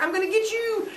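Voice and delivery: very high, gleeful taunt; playful battle cry. Acted. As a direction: chase your brother in play.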